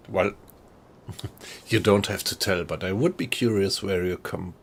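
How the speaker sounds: ordinary talk, nothing marked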